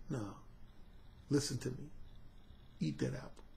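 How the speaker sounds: low hushed purr; soft satisfied murmur; quiet, low and pleased; smooth serpent. Retold or imitated. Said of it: "that serpent was smooth".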